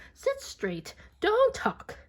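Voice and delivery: high, sharp classroom order; teacher scolding the class; strict teacher. A narrator's reading. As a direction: snap the pupils into line. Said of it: "telling the class".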